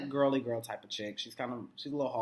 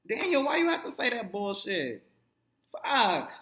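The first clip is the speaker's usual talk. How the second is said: exasperated scolding; 9 semitones higher, 5 dB louder than usual. Real, not acted. Scolding character on screen.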